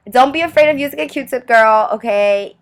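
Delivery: sassy. Acted. Sassy advice to viewers.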